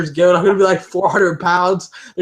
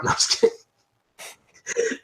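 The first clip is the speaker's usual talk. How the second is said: laughing; 6 dB quieter, much slower than usual. Real, not acted. Laughing after tutu joke.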